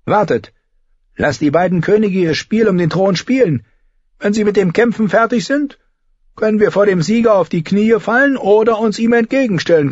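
put-on markedly high-pitched old courtier's voice; old knight, persuasive. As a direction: talk the lords into waiting and negotiating. Old Sir Stevron counsels waiting.

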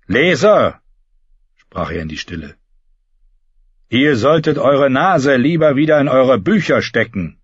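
a lightly voiced cold threat; Euron. A narrator's reading. Euron threatening the Reader, lightly cold.

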